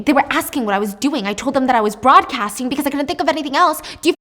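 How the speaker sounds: loud, fast, rising into a furious yell; panicked screaming. Acted. Eve screams at the corrupt police chief on the phone, panicked rage. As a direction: scream at him, losing control. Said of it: "And she's screaming".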